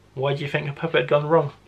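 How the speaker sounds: ordinary delivery, nothing marked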